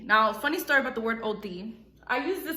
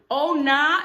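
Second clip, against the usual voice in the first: "Oh, nah" a bit higher and loud; oh nah exclaimed; 4 semitones higher, 7 dB louder than usual. Shown as a demonstration. Demoing the slang to viewers, loud exclamation. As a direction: belt out "Oh, nah" like it's freezing.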